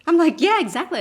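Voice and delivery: bubbly over-polite refusal while laughing; chirpy Canadian brush-off, high and loud; Canadian happy. Retold or imitated. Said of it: "just Canadian happy".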